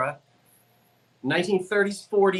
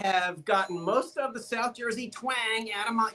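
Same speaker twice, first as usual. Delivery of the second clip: normal talking voice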